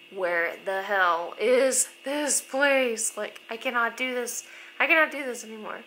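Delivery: retold near-tears exasperation. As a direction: act out being at the end of your rope. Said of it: "about to start crying".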